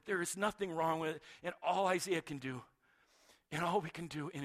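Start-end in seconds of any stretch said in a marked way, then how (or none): none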